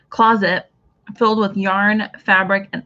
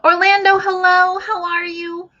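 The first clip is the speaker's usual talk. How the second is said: excited greeting; 10 semitones higher than usual. Real, not acted. Host calling out hello to a viewer, excited.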